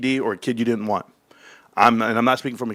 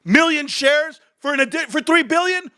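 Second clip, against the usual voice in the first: furious, much higher ranting at the camera; heated rant; 11 semitones higher than usual. Real, not acted. Streamer ranting to viewers about GameStop, furious high-pitched rant. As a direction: rail against Wall Street, voice pitched way up. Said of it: "I'm extremely animated".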